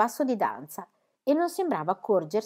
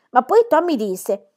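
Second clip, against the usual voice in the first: ordinary delivery, nothing marked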